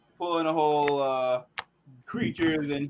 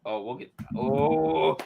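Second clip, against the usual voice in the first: ordinary delivery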